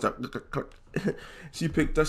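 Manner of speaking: ordinary talk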